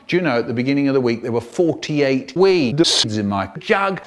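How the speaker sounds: ordinary delivery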